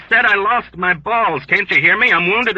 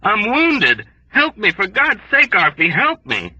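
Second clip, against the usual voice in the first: desperate plea; 10 semitones higher, 3 dB louder than usual. A narrator's reading. Wounded man begging.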